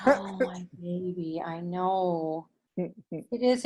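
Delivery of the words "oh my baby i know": nanny voice. Real, not acted. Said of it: "they're used to me being in my grandma voice, my nanny voice".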